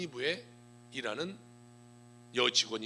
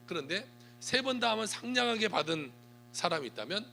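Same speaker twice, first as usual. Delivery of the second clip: ordinary delivery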